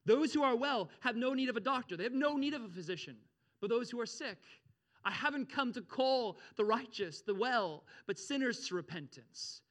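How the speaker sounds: loud, high-pitched preacher's emphasis; emphatic preaching. Real, not acted. Preacher re-reads verse for emphasis.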